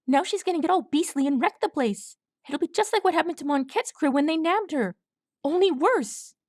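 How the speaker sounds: high, breathless frightened colt voice; panicked young colt. A narrator's reading. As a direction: blurt it out like a terrified little colt. Colt Swab urging.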